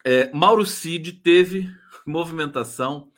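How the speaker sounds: usual speaking voice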